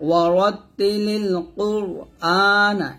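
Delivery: slow recitation with a full, heavy র in কুরআনা; ওয়া রত তি লিল কুরআনা drawn out with a thick র. Shown as a demonstration. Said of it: "র সেখানে মোটা করতে হবে".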